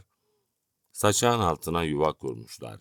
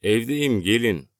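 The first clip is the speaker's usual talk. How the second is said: loud, higher call to the door; 7 semitones higher, 6 dB louder than usual. A narrator's reading. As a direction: invite them in from inside. Narrator calls back to visitors. (kapıdakilere yüksek sesle seslenerek)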